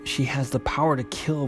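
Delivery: usual speaking voice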